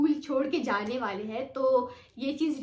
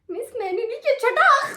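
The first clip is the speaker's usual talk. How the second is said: retold crying plea; 9 semitones higher, 9 dB louder than usual. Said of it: "मैं रो रही हूँ".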